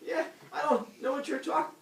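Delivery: high-pitched mock innocence; comic squeaky denial; feigned innocence. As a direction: brush it off like a caught liar. Caught liar plays dumb.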